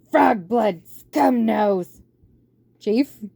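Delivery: loud, jeering rat voice; rat henchman. Acted. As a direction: sneer out the nickname. Rat names insulted.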